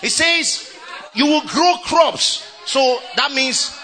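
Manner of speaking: a joyful preacher's ringing call; shouted exhortation. Acted. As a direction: rouse them and hammer it home. Excited exhortation.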